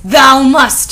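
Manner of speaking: loud, grand villain command; acted yell. A narrator's reading. Voldemort insisting Ebony obey, in an acted yell. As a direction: insist with theatrical force. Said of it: "he yelled".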